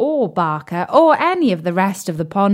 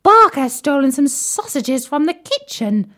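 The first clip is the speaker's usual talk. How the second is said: tadpole voice; 6 semitones higher, 3 dB louder than usual. Acted. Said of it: "said Tim the tadpole".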